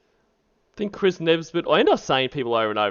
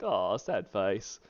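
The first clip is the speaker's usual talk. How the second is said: slow, soft, low 'oh'; mock sad; 6 semitones lower, 9 dB quieter, much slower than usual. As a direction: sigh it out in sympathy, softly. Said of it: "I always feel bad when I see Sad Face".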